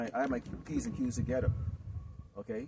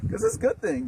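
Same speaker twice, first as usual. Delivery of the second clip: squealed emphasis; 9 dB louder than usual. Acted. Preacher praising obedience.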